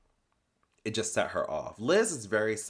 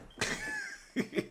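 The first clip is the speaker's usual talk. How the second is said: high squeal laugh; 6 dB quieter than usual. Real, not acted. Laughing at the scene.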